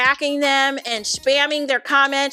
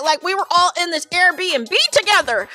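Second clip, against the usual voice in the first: ordinary talk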